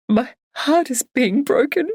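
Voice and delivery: "heart" lifted and stretched through tears; sobbing. Acted. Barbara crying to her friend, "heart is being broken" slow and tearful. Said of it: "stronger bout of sobbing".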